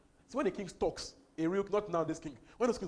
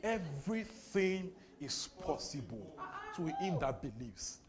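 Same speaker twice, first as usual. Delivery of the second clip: slow; 2 semitones lower, 4 dB quieter, slower than usual. Shown as a demonstration. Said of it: "Wait, wait, wait".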